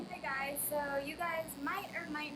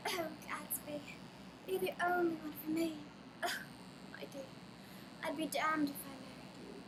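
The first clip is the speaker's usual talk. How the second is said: hammed-up dramatic old-time lady; Gatsby-era socialite; 3 semitones higher than usual. Shown as a demonstration. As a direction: play a swooning, haughty Gatsby-era belle. Old-time belle in accent demo.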